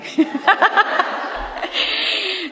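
high delighted laugh; high burst of laughter. Real, not acted.

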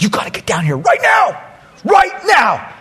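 urgent summons. Retold or imitated. Colleague urgently summoning him.

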